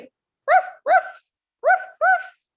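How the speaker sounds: very high yappy barks; dog bark. Shown as a demonstration. Teacher barking like a puppy for children. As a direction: yap like a small puppy. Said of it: "what sound a dog makes".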